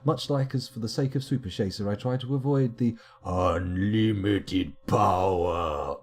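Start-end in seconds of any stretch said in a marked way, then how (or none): 3.2-6.0 drawn-out, hammed-up villain cry; unlimited power meme. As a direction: stretch it out like a theatrical meme quote.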